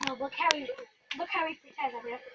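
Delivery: fast hushed whisper. Real, not acted. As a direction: whisper so the dog won't hear. Whispering about the dog.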